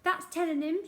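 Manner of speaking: put-on old woman shrieking approval; shrieked. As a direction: cheer the headmaster on spitefully. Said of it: "shrieked Missus Pratchett".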